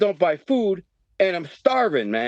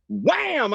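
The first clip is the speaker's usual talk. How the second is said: punch sound; 10 semitones higher than usual. Retold or imitated.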